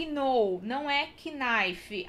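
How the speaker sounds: know and knife with a k before the n; k sounded. Shown as a demonstration. Teacher showing the wrong know and knife. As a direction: say kuh-now and kuh-nife.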